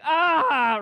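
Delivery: high, loud mock scream; retold scream. Son just had the Band-Aid ripped off, retold scream. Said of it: "he is just screaming".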